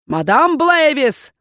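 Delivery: slow, loud angry bark. Acted. Dean searches the room and barks, loud angry shout. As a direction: bark the name like an order. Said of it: "рявкнул Кхец".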